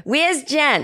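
high-pitched mom impression; mother calling out. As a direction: imitate mom hollering for her daughter. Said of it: "my mother will be doing".

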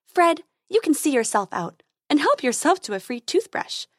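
high bright fairy voice; sweet high tooth fairy. Acted.

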